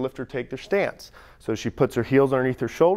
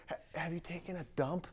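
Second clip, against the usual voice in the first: very soft, hesitant mock-confidential voice; hushed delicate question; 17 dB quieter than usual. Coach retells asking a lifter an awkward question, hushed and hesitant. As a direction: drop right down as if asking something private.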